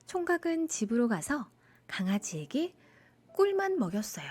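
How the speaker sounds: usual speaking voice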